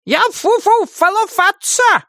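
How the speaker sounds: very high witch voice, mouth full; sputtering angry witch. A narrator's reading. Witch spits out a rabbit's foot, sputtering angrily. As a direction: splutter crossly round something in the mouth. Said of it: "в сердцах выплюнула она попавшую ей в рот".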